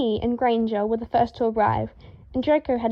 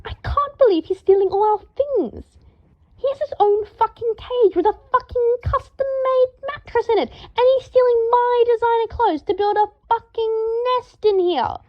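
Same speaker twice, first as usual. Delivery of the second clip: high, loud rant building to a scream; rising furious scream; 12 semitones higher, 5 dB louder than usual. A narrator's reading. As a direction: let the fury climb to a scream. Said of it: "his voice had steadily risen until he was screaming".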